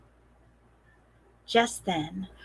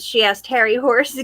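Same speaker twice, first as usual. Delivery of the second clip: ordinary delivery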